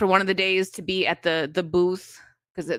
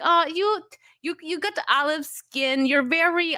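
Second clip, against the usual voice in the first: higher-pitched, light mock Russian accent; attempted Russian accent; 8 semitones higher than usual. Streamer retelling a middle school classmate's remark, attempted Russian accent. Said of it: "I can't do a good Russian accent".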